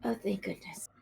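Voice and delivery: whispered relief, breathy and soft; relieved whisper. Acted. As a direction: sigh it out in a whisper. Relieved character after being put down.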